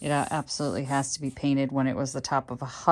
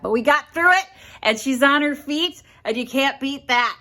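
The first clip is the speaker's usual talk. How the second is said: triumphant; 11 semitones higher, 8 dB louder than usual. Real, not acted. Celebrating the finished project.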